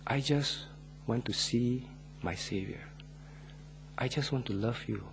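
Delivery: put-on softly, a small child's timid reply; a frightened little girl answering quietly. Little girl answering the missionary at night, timid and soft. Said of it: "in a timid voice".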